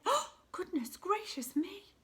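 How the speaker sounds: high, gasped surprise; gasp, then exclaim in mock shock; shocked exclamation. A narrator's reading. Mother looking into the water, high startled exclamation.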